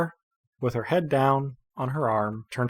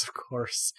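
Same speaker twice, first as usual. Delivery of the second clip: "of course" dropped to a whispered mutter, much softer; muttered under the breath; 11 dB quieter than usual. Acted. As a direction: grumble it to yourself. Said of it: "And she goes".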